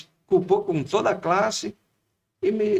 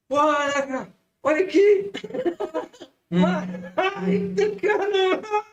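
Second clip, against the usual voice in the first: much higher, laughing out the words; 13 semitones higher, slower than usual. Retold or imitated. Schoolmates laughing at the bully, imitated with high laughter. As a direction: mimic the kids cracking up.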